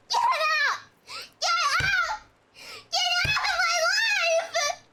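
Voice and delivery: high, loud, breaking scream; yelling. Acted. Husband screaming as his girlfriend slams the door. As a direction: throw her out at the top of your voice. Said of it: "Johnny is yelling".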